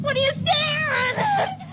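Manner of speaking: very high, slow screaming question; wild shriek. Acted. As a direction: scream it as the change takes her. Wife, changing, faces her husband.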